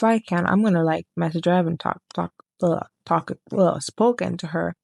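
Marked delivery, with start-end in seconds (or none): none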